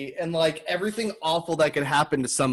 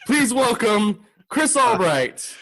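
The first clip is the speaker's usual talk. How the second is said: mock announcer intro; 8 semitones higher, 3 dB louder, slower than usual. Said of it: "host introduces guest theatrically".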